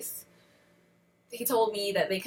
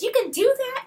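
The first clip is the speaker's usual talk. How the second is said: high, loud mock manager voice; dismissive retort; 9 dB louder than usual. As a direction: imitate a rude manager brushing off a threat. Manager's retort before hanging up.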